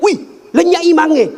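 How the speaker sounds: put-on high-pitched boy's cry of alarm; retold shout. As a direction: cry out in mock shock as a boy who just looked up. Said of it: "dia teriak". (seruan kaget bernada tinggi, menirukan anak berteriak)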